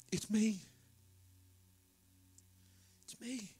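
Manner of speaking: soft pleading. Acted. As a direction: gently beg them to see you. Joseph pleading softly.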